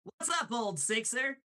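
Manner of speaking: high-pitched cartoon greeting; cartoon villain voice. Voice actor demoing Bill's greeting, high cartoon voice. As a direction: toss it out in a high character voice. Continues demo of Bill voice.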